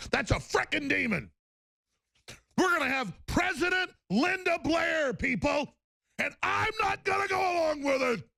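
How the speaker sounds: very high screaming rant; real full-throated shout; frenzied shout. Real, not acted. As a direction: scream it at the top of the range. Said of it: "Excuse me".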